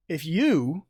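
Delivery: YOU lifted and punched; emphatic "you", aimed at one person; stress on you. Shown as a demonstration. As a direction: lean hard on "you", pointedly. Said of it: "this actually tells you how to read it".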